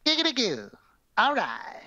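high-pitched cartoon lech catchphrase; Quagmire. Podcaster quoting a cartoon character, high-pitched impression. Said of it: "Quagmire's hilarious".

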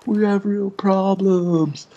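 slow, high, hammed-up whimper; imitating a sobbing member, half-hearted; mock crying. Imitating a crying meeting member, slow, high and mock tearful. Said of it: "some guys are crying".

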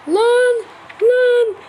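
high loud panicked cries; panicked yelling. Real, not acted. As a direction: yell in sudden panic. (panikle bağırarak)

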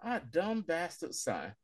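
soft and slow, dawning regret; hushed realization of own mistake; quiet slow dismay. Retold or imitated. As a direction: let the guilt sink in softly and slowly.

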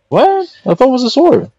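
sudden high startled question; surprised. Real, not acted. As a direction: caught off guard, pitch jumps up. Surprise at game item.

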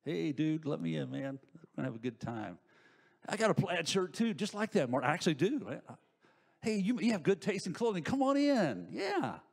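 casual buddy talk climbing high and excited; Marty. Acted. Devil dressed as a pal asking to come in, chummy and wheedling. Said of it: "does he come dressed like Marty".

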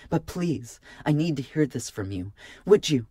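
beg, building to an insistent question; pleading. A narrator's reading. Marka needing to hear it from Jervin, pleading.

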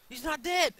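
high, loud cry of disbelief; real high-pitched yelp of surprise; startled shout. Real, not acted. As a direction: yell it as if caught off guard. Startled that enemy is alive.

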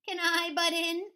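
high, loud goat question; Nanny Goat. Acted. Goat offers to help push, high loud question. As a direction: ask eagerly in a goat voice. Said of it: "asked Nanny Goat".

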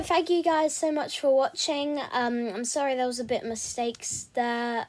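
plain reading voice

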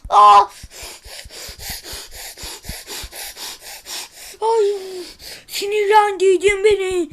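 loud crying shout; furious screaming outburst; angry yell. Acted. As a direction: yell it out in a fit of rage. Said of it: "Ne bağırıyorsun?".

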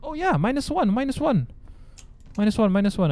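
high excited eureka; light up with the answer; excited realization. Real, not acted. Puzzle realization.

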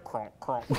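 low, soft imitation crunching; imitated gnawing noise. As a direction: mimic the noise inside the wall. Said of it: "I hear it, like".

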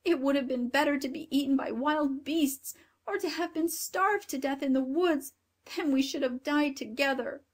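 put-on high-pitched lament of a grieving girl; tearful exclamation. A narrator's reading. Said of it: "as the tears rolled down her cheeks, she exclaimed".

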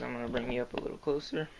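normal talking voice

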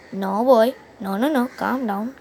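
usual speaking voice

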